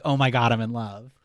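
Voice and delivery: a loud, hammed-up cry of discovery; mock loud revelation. Ballplayer tells his buddies he's in love, with a loud mock cry. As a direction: blurt it out like a sudden realization. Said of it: "And Owen Wilson's like".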